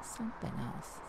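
breathless whisper of realization. Acted. Thief sizing up the stranger, whispering. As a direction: barely voice it, eyes on him. Hiding, realizing he's not a cop.

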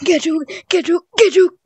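loud, high gloating yells; gloating taunt. Real, not acted. Taunting the other player, loud high gloating. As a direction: rub it in after the tag. Gloating after tagging in game.

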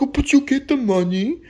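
very high mock whine; squeaky imitated objection; pleading protest. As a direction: plead like a thwarted buyer. Buyer pleads for scooter.

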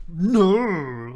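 loud sharp refusal; pissed-off granddaughter snapping no; angry no. Retold or imitated. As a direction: bark it out, offended. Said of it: "Like, pissed off".

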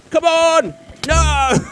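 exasperated outburst at dying; frustrated shout. Real, not acted. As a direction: yell at the game as you lose. Dying again in the game.

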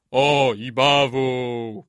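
slow, loud droning echo; chorus. A narrator's reading. Chorus repeating the old man's curse, drawn-out drone. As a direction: intone the reply like a whole crowd. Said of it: "powtórzył chór".

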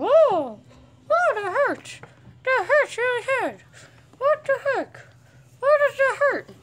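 high squealing protest; playful pained yelps. Real, not acted. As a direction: yelp as the dog nips. Dog biting him in play.

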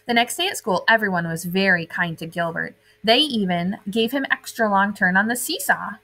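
put-on lively read-aloud for kids; animated children's story narration; storybook reading. A narrator's reading. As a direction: read it like a bedtime picture book. Reading picture book to children.

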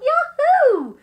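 high, very loud whoop; yelled cheer. Acted. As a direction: yell it out with glee. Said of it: "we yelled".

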